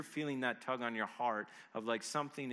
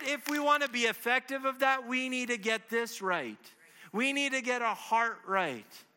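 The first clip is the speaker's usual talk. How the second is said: raised, louder, higher pulpit appeal; impassioned preaching; 7 semitones higher, 7 dB louder than usual. Real, not acted. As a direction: press the congregation with urgent conviction.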